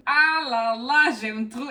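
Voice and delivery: slow, high, loud swoon; drawn-out, loud "ah là là"; gushing delight. Real, not acted. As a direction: melt over something beautiful. Admiring the book's details.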